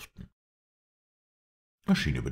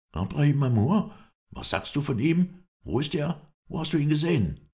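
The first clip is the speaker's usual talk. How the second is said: high, loud, urgent questions; startled outburst, pitch far up; agitated alarm; 10 semitones higher, 5 dB louder than usual. A narrator's reading. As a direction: fire off questions in shocked agitation. Isla leaps up at enemy's name.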